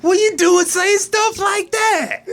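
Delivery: mock-scandalised shout breaking into laughs; laughing outraged shout. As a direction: yell it while barely holding in a laugh. Trash man outraged, laughing.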